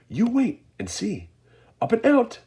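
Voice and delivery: high, loud eager voice; Mister Wonka gleeful. A narrator's reading. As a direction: tease them with glee.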